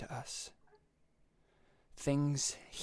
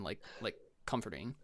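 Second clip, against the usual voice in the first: usual speaking voice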